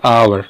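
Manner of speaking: आवर said with a silent h, opening on the vowel आ; आवर, starting straight on the open आ; pronouncing hour. Shown as a demonstration. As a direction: say आवर as "aa-var" with no h. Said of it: "इसमें देखोगे H O यू आर".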